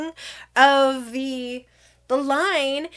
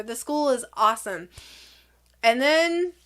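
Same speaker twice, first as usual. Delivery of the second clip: ordinary delivery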